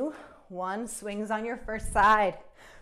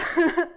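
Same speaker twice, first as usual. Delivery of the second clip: short laugh; 7 semitones higher, 8 dB louder than usual. Real, not acted. Laughs during workout instructions.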